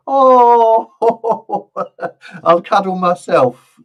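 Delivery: loud cry of laughter, then slow laughing words. Real, not acted. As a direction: burst out laughing at yourself and drag the words. Laughing at own cuddle advice.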